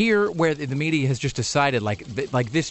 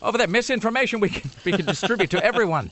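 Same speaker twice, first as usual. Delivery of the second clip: high mock alarm, pointing away; mock alarmist; 9 semitones higher than usual. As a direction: sell the scare like a huckster. Mocking fearmongering media.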